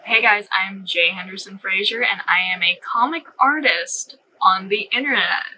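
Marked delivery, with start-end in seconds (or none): none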